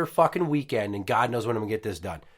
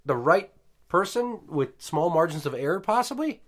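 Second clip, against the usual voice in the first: incredulous; 6 semitones higher than usual. Real, not acted. Building incredulous rant.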